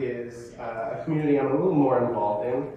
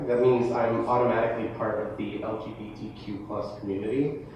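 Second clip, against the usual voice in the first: usual speaking voice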